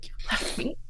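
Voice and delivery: hushed, unvoiced hurt reproach; wife hushing a reproach to her caged husband; hurt accusation. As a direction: breathe it out as a wounded accusation. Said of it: "kind of just glares at him".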